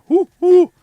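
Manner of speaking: high, loud owl hooting; Swedish owl hoot. Shown as a demonstration. Streamer showing how a Swedish owl might sound, hooting. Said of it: "en svensk uggla kanske låter".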